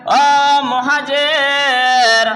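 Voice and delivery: long drawn-out, sung-out call. Retold or imitated. Muhajir calls to his own people for help, drawn-out call. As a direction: imitate a cry for help, stretching the name. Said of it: "চিৎকার দিয়ে বলে".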